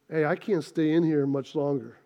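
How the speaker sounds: low, quiet creaky grumble; hushed, low-pitched mock complaint; unborn baby speaking. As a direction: play a baby muttering from inside the womb. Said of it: "An infant in the womb is basically at nine months saying".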